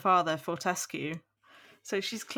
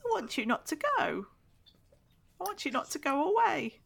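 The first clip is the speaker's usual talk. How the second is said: put-on very high, squeaky small child calling up the stairs; eager little boy's voice far above the narrator's own; weird little boy voice; 10 semitones higher than usual. A narrator's reading. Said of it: "giving Ronnie a really weird voice".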